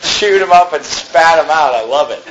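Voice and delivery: chuckling through the words; amused preacher laughing. Real, not acted. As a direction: laugh while telling it.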